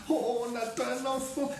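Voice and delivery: storyteller playing overjoyed new parents; acted delight. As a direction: marvel in a high voice. Parents rejoice in the tale.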